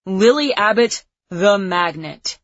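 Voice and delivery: booming, slow movie trailer voice; movie announcer voice. A narrator's reading. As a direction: announce it like an epic movie title. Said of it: "in a movie announcer voice".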